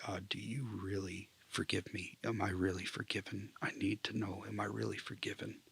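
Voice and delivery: softly recited plea, one question after another; retold repeated prayer. Man retells begging God to know he is forgiven, softly pleading. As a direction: plead under your breath, retelling a prayer. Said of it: "I just kept praying that over and over".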